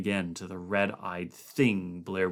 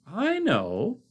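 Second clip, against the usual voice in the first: protesting; 12 semitones higher, 3 dB louder than usual. A narrator's reading. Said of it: "Blair protested".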